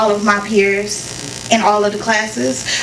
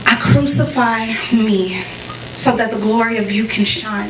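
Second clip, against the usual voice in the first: earnest prayer, lightly intoned. Acted. Religious girl thanks her creator, in earnest prayer. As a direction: pray it sincerely before the show.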